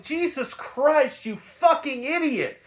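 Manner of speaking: exasperated. Retold or imitated. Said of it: "I'm just like".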